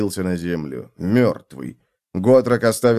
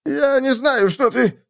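very high, choked protest; strangled squeak; slower than usual. A narrator's reading. Captive as thick fingers tighten on him, strangled squeak. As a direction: plead high until it squeezes off. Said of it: "фраза человека завершилась сдавленным писком".

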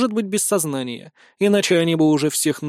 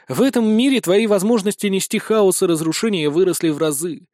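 ordinary delivery

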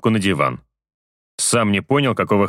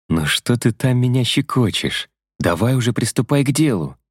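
higher female voice, mildly impatient; impatient girlfriend voice; 4 semitones higher than usual. Acted. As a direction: nudge him along, half teasing. Vasya impatient.

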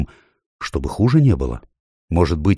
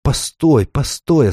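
high faun cutting in; interrupting faun; 8 semitones higher, slower than usual. A narrator's reading. Faun halting the dwarf to explain, interrupting. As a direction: stop him in a high voice. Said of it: "остановил его Фавн".

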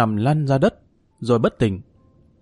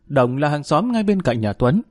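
usual speaking voice

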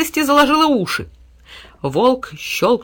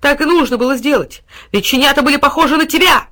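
high, loud, defiant; defiant raised answer; shouted retort; 6 semitones higher, 5 dB louder than usual. A narrator's reading. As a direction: throw the insult back at full voice. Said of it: "прокричал в ответ пёс".

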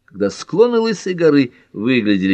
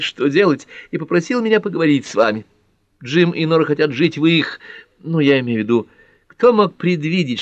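put-on higher-pitched young woman's chatter; Pat, lively and confiding; 7 semitones higher than usual. A narrator's reading. Pat confiding her father's worry to Ellery, lively high voice. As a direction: voice an earnest, effusive woman, pitched up.

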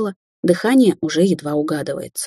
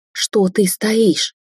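slow, higher pleading yell; shouting; 5 semitones higher, much slower than usual. A narrator's reading. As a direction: drag the words out in a desperate shout. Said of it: "закричала она".

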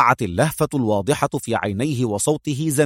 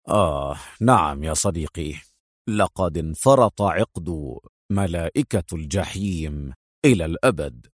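calm relief; 8 semitones lower than usual. A narrator's reading. Agent reporting success to his friend, calm, low and relieved. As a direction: let it out unhurried and low, relieved. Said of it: "في هدوء وهو يقول في ارتياح".

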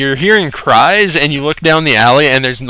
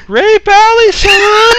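high, far-off hammed-up yell; mock street shout; slower than usual. Friend imitating a guy shouting at the alley, hammed-up high yell. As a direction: belt it like a rowdy local. Retelling guy's loud exclamation.

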